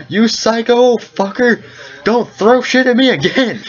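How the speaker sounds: high, slow outraged holler, drawn out; outraged yell. Acted. Game master voicing the man hit by a thrown object, outraged yell. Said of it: "And then you hear".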